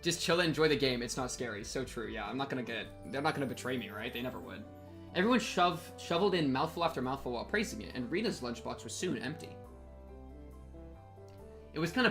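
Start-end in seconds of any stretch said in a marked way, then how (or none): none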